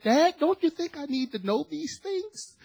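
high-pitched mock indignation; incredulous protest. Son protests to dad about the secret, incredulous protest. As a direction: push it way up high, imitating a dumbfounded son.

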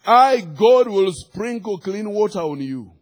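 loud, high opening, preached with authority; divine proclamation. Real, not acted. Preacher voicing God's promise to congregation, forceful proclamation. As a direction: declare it as God's mouthpiece.

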